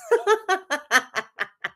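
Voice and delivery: a real laugh climbing high; high-pitched laugh. Real, not acted. Host laughing at Kay's complaints, high real laugh.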